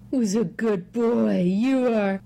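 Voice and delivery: put-on slow sing-song baby talk to a cat; talking to pet. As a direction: coo lovingly at your kitten.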